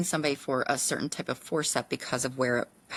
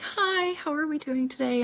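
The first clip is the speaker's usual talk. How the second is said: much higher, sing-song greeting; bubbly doctor; 11 semitones higher than usual. Retold or imitated. Excited doctor greeting her nervous patient, bubbly.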